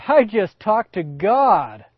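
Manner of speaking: slow, loud, wide-eyed news; awed announcement. Acted. Abraham telling Sarah he spoke to God, slow and loud. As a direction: savour the big news. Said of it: "Abraham bursts through the tent and goes".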